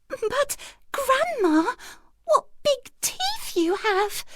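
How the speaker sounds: put-on a frightened child, voice wobbling; the girl sees grandma's teeth, trembling and scared; voice shaking. A narrator's reading. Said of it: "her voice shaking".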